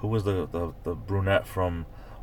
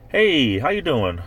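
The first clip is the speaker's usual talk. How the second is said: high, louder mock-cheery hail; chirpy acted greeting, pitched up; friendly greeter; 8 semitones higher, 5 dB louder than usual. Hailing a New Hampshire local, high friendly greeter. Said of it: "you say".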